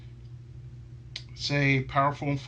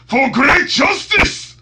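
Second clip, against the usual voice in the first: slow, loud, high war cry; heroic battle cry; 13 semitones higher, 14 dB louder, slower than usual. Retold or imitated. Shouting the final line as the Steel Samurai, heroic yell. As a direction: bellow it like a samurai hero. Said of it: "the last thing I said".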